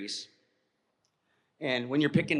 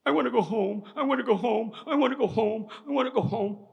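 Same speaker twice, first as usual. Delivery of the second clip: put-on higher, pleading whine of a scared kid; panicky child meltdown; 8 semitones higher than usual. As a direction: beg to go home like a frightened boy. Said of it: "he goes into a meltdown".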